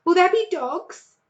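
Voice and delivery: small voice full of fear; frightened child. A narrator's reading. Fox cub asking his mother, frightened. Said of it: "huge with fright".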